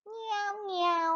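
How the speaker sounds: put-on teacher mewing for the children; cat meow imitation. Shown as a demonstration. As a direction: mew like a kitten.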